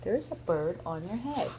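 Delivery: plain reading voice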